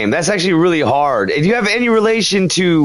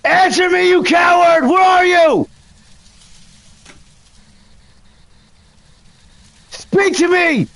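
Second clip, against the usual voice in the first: angry shout; 12 semitones higher than usual. Real, not acted. Ghost hunter demanding the ghost Jay Walker answer, angry shouting. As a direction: bark at an unseen coward.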